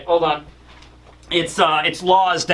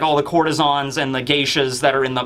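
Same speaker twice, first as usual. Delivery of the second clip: normal talking voice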